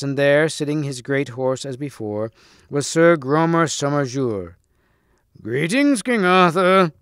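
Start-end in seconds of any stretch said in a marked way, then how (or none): 5.4-6.9 high, ringing greeting; evil knight's raised-voice call; crying out. A narrator's reading. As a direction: hail him loudly, building on the name. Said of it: "he cried".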